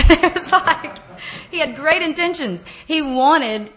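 high, laughing through the words. Real, not acted. Telling the crack story, laughing high and loud.

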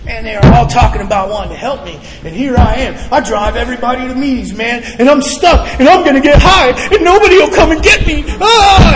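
high rant building to a loud wail; pissed-off panicked rant. Retold or imitated. Stranded man raging that his friends won't help, frantic. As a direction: work yourself up until you cry out. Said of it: "I started getting pissed off".

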